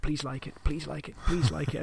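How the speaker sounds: soft, hopeful begging; under the breath plea; quiet pleading. Retold or imitated. Hoping players like it.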